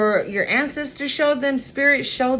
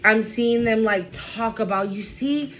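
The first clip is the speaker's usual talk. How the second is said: ordinary talk, nothing marked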